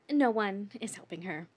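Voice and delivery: normal talking voice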